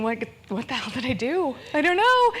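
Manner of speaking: baffled man, ending in a high squeak; bewildered boyfriend. Retold or imitated. Boyfriend panics at her sobbing, baffled and high. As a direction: play the confused boyfriend rising to a panicked squeak. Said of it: "this poor man is thinking".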